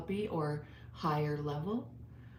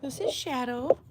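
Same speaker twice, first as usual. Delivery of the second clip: dog voice; 8 semitones higher, 5 dB louder than usual. Acted. Introducing her dog to viewers.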